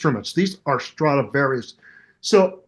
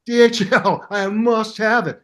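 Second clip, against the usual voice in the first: eager; 5 semitones higher than usual. Retold or imitated. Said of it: "I go".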